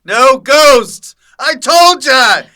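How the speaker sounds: high, very loud hammed-up holler; mock outraged yelling. Boss banning ghost pictures from the lobby, mock outraged yell.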